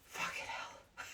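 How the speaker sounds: hushed, shocked aside; a private muttered oath; whispered curse. Real, not acted. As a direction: whisper Fucking hell under the breath. Disbelief at the nurse's name.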